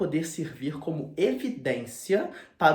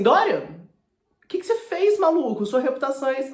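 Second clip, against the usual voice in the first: shocked Basil scolding; 10 semitones higher, 7 dB louder than usual. Retold or imitated. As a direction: gasp it out at him in a high, alarmed voice.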